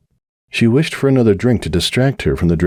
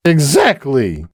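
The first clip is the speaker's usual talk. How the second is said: slow, emphatic tipsy exclaim; 8 semitones higher, much slower than usual. Acted. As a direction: land it like banging the glass. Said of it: "she exclaimed".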